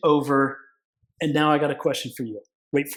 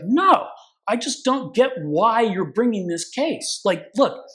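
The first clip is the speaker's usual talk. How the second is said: raised voice, higher pitch, baffled and stern; incredulous judge; 6 semitones higher, slower than usual. Retold or imitated. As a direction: play the judge who can't believe this case. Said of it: "and he's like".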